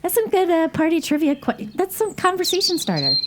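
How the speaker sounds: high, bouncy teasing voice; playful teasing. Real, not acted.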